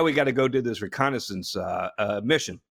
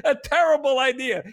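laughing; 12 semitones higher than usual. Real, not acted. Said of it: "laughs on these lines".

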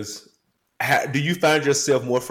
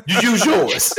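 higher, louder mock-sassy brush-off; sassy dismissal; 8 semitones higher, 5 dB louder, slower than usual. A dad refusing his kids' coping advice, higher and sassy. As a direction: toss it back at the kids with sass. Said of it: "I'd be like".

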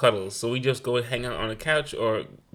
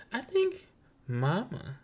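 lightly voiced little boy, high on I think; a kid's sweet answer, dropping to Mama; sweet child voice; 5 semitones higher, 5 dB quieter, slower than usual. Son tells his mother his favorite teacher is Mama, sweetly. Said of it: "He said it so sweetly".

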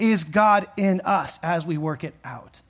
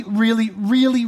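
each really stretched slow and pushed louder; drawn-out emphasis; 4 semitones higher, 6 dB louder, slower than usual. Real, not acted. Preacher stretching out really, really for his listeners. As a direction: lean hard on every really, slowly. Emphasising how good-looking Joseph was.

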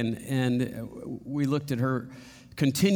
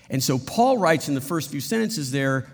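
ordinary talk

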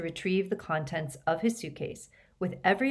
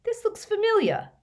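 slow, high-pitched puzzlement; slow, suspicious and pitched up; slowly; 4 dB louder than usual. A narrator's reading. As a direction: draw it out as recognition dawns. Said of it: "Ron said slowly".

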